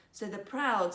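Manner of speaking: ordinary delivery, nothing marked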